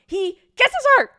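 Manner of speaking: high, very loud squeal of excitement; excited shriek. Real, not acted. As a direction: shout the big reveal, drawn out. Excited shriek at story's climax.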